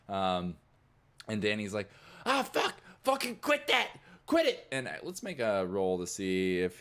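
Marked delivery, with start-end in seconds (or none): 2.3-4.7 high, loud yelling; alarmed angry guard. Acted. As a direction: yell to break up a fight. Guard breaking up the fight.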